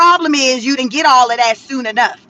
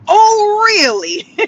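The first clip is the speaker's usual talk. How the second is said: high, loud theatrical "Oh, really?"; mock surprise; 7 semitones higher, 3 dB louder, much slower than usual. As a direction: play up fake shock. Mock reaction to late curfew.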